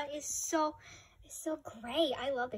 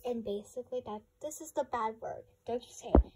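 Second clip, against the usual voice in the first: ordinary talk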